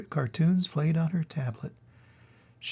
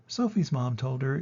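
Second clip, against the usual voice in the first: usual speaking voice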